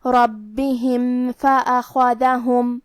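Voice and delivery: slow chanted ربہم with a clearly sounded م before فأخذہم; measured tajweed chant, plain unhidden م in ربہم leading into فأخذہم; clear meem, no ghunnah. Shown as a demonstration. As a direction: recite ربہم فأخذہم slowly, closing the م cleanly without a nasal hold. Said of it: "میم ظاہر کریں گے اور بغیر غنہ سے".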